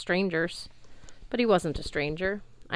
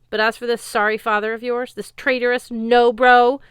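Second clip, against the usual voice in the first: scornful rant; 5 semitones higher, 10 dB louder than usual. Acted. Sword insults Frey.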